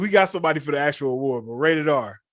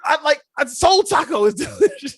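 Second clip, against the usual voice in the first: higher, louder mock hype voice; imitating hyped promo; 11 semitones higher, 5 dB louder than usual. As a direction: ham up an excited taco endorsement. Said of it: "it's gonna be like".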